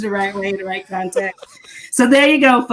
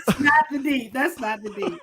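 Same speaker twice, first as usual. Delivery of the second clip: laughing; 5 dB quieter than usual. Real, not acted.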